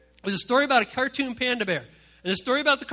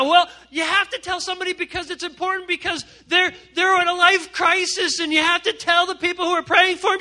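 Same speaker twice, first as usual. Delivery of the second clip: high, loud, overwrought justification; protesting gossip; 9 semitones higher, 7 dB louder than usual. Acted. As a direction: plead your case like a worked-up gossip. Mimics gossip's frantic excuse.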